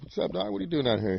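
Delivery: high, soft quoted greeting; retold surprised greeting. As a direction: imitate greeting a friend you didn't expect. Retold greeting at chance meeting.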